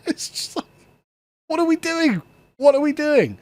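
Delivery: high, laughing incredulity; laughing disbelief. Real, not acted. Host laughing at the Canadian government, incredulous.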